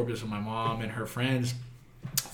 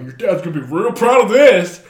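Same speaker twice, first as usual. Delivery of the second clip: louder, higher sarcastic jab; mocking cop; 8 semitones higher, 14 dB louder than usual. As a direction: sneer at the drunk kid in the back seat. Cops mocking him.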